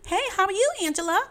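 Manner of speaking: high, exaggerated sing-song hello; mocking mom's cheery greeting. Son mimics his mother's morning greeting, mock-cheerful. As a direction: mimic an overly happy mother's phone voice. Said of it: "that's how you sound".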